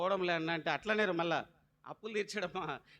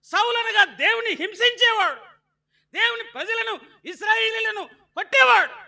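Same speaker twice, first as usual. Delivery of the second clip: high, loud shouted sermon; full-force pulpit shout; fiery preaching; 13 semitones higher, 11 dB louder than usual. Acted. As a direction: drive it home to the church.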